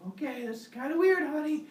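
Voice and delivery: high, puzzled wife's voice. Acted.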